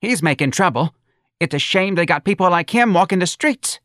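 high, loud complaining mother; indignant mother. A narrator's reading. Mother demanding the policeman remove the old man, high and indignant.

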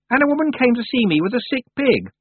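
put-on high, sharp girl's voice; haughty young witch Anagramma. A narrator's reading. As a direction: voice the proud young witch, peevish. Anagramma's voice in dialogue.

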